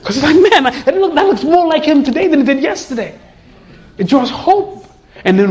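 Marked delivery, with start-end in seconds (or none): 0.4-3.1 much higher and brighter with a laugh; thrilled to see the likeness grow; delighted exclamation. Retold or imitated. Hopeful exclamation at progress.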